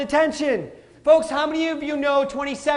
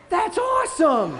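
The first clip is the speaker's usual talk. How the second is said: delighted dad; 7 semitones higher, slower than usual. Retold or imitated. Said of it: "I'm like".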